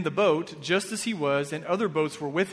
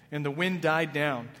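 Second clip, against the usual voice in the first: normal talking voice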